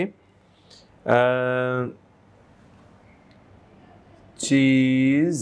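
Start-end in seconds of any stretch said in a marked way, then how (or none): 4.4-5.4 চিজ stretched out very slowly, ending on a drawn zh sound; slow demo of চিজ. Shown as a demonstration. Teacher showing learners how to say চিজ slowly. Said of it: "আমরা examples দেখি".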